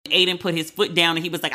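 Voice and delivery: ordinary talk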